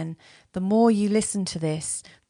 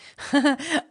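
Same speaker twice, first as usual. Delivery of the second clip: short, high chuckle; light laugh; 8 semitones higher, 5 dB louder than usual. Real, not acted. Joking to listeners about unsafe listening, light laugh. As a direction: let out a quick bright giggle. Amused by her own warning.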